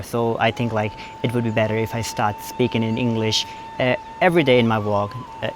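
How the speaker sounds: careful, drawn-out American accent. Shown as a demonstration. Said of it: "थोड़ा English American में बोल के देखते हैं". (धीमा, गहरा अमेरिकन लहजा)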